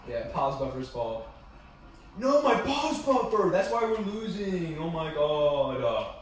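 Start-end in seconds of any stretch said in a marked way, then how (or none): 2.2-6.1 high, loud, slow hammed-up fury; mock rage. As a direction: ham up the tantrum, shrieking. Mock rage about pause buffer.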